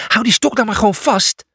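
high, exasperated yell; shouted order. Acted. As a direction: shout an order over the storm.

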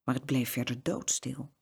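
ordinary delivery, nothing marked

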